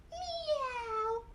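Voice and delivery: high, soft kitty mew; cat meow. Acted. Storyteller shows the cat sound, high soft meow.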